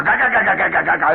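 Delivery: car shimmy noise. Retold or imitated. Car bouncing over tracks on the way to work, rattling. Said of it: "It's going like that".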